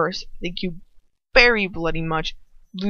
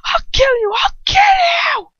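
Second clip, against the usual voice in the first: much higher, screaming rage, building to a cry; furious shout; 7 dB louder than usual. A narrator's reading. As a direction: scream it as you charge him. Ron attacks Lucius in rage.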